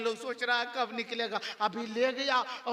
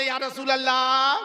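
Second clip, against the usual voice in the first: loud invocation at usual pitch; first, less forceful rallying cry; devotional call; 8 dB louder than usual. Shown as a demonstration. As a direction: call it out for the congregation to follow. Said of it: "एक मर्तबा बोले".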